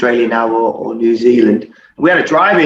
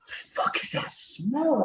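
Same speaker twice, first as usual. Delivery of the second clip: slow hushed whisper, baffled by a smell; retold whisper; 9 semitones higher, 15 dB quieter, much slower than usual. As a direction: breathe it out, puzzled and secretive. Retold moment noticing a stench.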